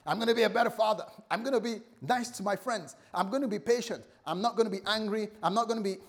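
acted-out eager churchgoer, high and loud; excited resolve. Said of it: "Sometimes we get excited".

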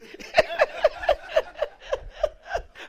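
high airy laughter; breathy laugh. Real, not acted. Preacher laughing with the congregation, airy. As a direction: let the laugh run.

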